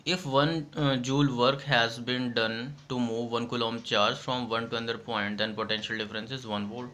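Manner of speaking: reading the bookish definition slowly for notes; slow dictation. Shown as a demonstration. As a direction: dictate each phrase so students can write.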